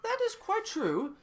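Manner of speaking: high, slow put-on British accent; mock-British impression in a high register; drawn-out, high fake British voice; Meghan Markle. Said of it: "he puts on a British accent".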